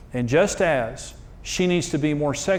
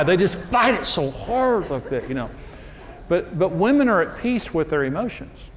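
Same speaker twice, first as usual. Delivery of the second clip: ordinary talk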